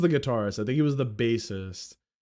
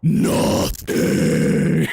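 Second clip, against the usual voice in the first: low, breathy growled whisper; mock metal band deadpan growl; death metal growl; 10 semitones lower, 6 dB louder than usual. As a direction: growl the word out low and airless. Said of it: "And then they go".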